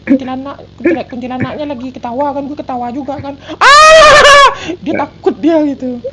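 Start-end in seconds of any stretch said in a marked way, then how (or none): 3.6-4.5 sudden high scream; startled scream. Acted. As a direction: shriek out in fright. Mock ghost fright in chat.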